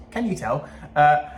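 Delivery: ordinary talk, nothing marked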